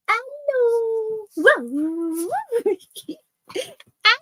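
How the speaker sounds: very high squealed hello then a laugh; high pet greeting with a giggle; squeaky hello. Real, not acted.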